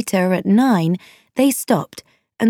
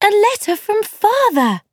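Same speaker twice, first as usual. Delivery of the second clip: very high-pitched delighted exclamation; excited cry; 14 semitones higher than usual. A narrator's reading. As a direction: cry out at a thrilling surprise. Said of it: "they cried".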